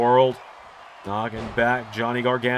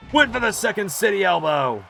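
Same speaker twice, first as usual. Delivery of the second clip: high, rising excited call; 8 semitones higher, 3 dB louder than usual. Real, not acted. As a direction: call the big move. Commentator calls big move.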